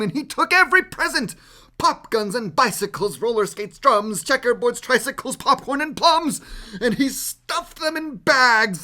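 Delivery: high, fast, lively list; quick list. A narrator's reading. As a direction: rattle off the loot. Narrator rattling off loot.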